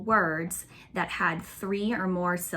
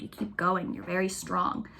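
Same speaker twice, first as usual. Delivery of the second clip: ordinary talk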